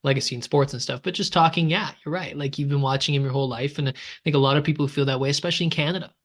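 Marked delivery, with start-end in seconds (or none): none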